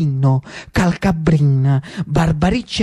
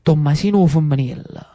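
ordinary delivery

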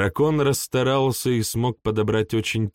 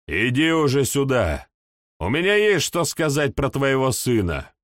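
shouting upward; 6 semitones higher than usual. A narrator's reading. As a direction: yell for him to show up. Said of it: "крикнул".

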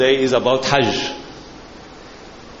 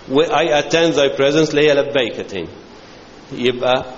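ordinary talk

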